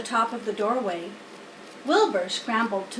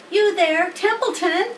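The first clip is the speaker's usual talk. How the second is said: much higher, louder call across the yard; 8 semitones higher, 6 dB louder than usual. Acted. Wilbur calling Templeton, loud high call. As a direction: hail the rat from afar. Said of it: "he called".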